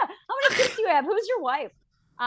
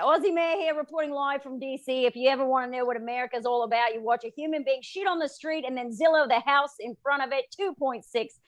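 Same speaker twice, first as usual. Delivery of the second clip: put-on a light Australian accent, reporter style; lightly put-on Aussie reporter voice. Comic showing off her Australian accent for the listeners. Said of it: "show off her Aussie accent".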